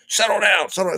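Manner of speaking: high-pitched imitation of an officer's order; mock officer command. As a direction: act out an officer ordering someone to calm down. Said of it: "doing the same, he's like".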